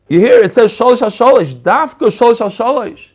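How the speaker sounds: shalish al shalish punched hard, loud and high; stress on shalish. Shown as a demonstration. Rabbi stressing shalish to his students, loud and emphatic. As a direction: hammer the word shalish so the class hears it. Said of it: "but not shloisha al shloisha".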